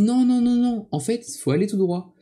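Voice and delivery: high, loud run of imitated no's; emphatic correction. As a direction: mimic someone urgently correcting a lost walker. Acting out retired couple correcting him.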